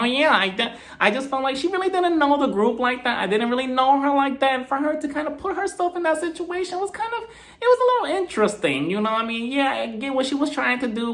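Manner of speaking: high-pitched, airy imitation of a woman; mock a castmate in a lifted, sustained high voice; Meredith. Imitates Meredith answering on the show.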